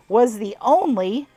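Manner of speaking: put-on amateur southern accent on an old farmer's tale; southern twang. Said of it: "a bit of a southern twang".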